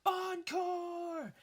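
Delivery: re-enacted high crowd scream. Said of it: "and I'm screaming".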